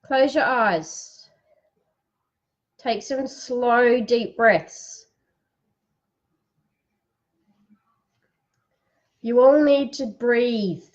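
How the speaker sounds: unhurried guided relaxation for the live audience; guided breathing. Acted. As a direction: lead them into calm, one phrase at a time. Leads a breathing exercise.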